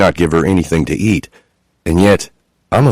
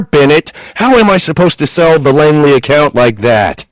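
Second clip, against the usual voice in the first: furious rant; 9 semitones higher than usual. A narrator's reading. Chloe in labour ranting at her husband, furious. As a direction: rant at him in full fury.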